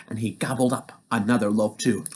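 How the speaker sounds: plain reading voice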